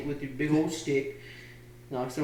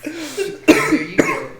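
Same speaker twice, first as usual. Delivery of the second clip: put-on mad scientist German accent; 13 semitones higher, 10 dB louder, much slower than usual. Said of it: "Mad scientist German up in here".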